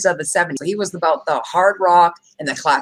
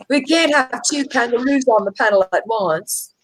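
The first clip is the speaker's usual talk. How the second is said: higher-pitched mock Australian; put-on Australian accent; 4 semitones higher than usual. Host teasing the Australian panelist, mock Australian accent. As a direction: tease in a put-on Aussie accent. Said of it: "Were you doing an accent?".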